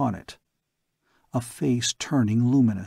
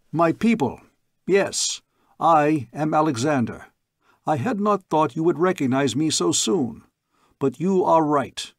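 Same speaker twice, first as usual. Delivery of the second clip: proclaiming to crowd; 4 semitones higher than usual. Acted. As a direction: announce yourself as king to the people below.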